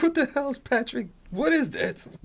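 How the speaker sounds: high, soft baffled voice; mock bewilderment. Host retells watching the shades move, high-pitched mock bewilderment. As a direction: squeak out the disbelief, lightly. Retelling puzzled reaction to edited video.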